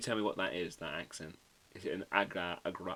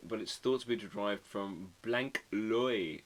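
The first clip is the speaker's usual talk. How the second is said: ordinary talk, nothing marked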